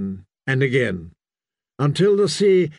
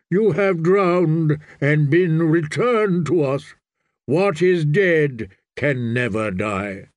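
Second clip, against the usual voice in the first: slow, measured ritual pronouncement; solemn priest; 2 semitones higher, slower than usual. Acted. Priest to the boy he revived, solemn and slow. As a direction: intone it slowly as holy rite.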